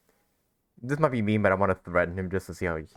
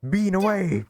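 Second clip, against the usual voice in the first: mock heroic yell, higher and louder; playful battle cry; 10 semitones higher, 4 dB louder, slower than usual. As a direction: hurl the words like a throw. Throwing beans at Ito, playful.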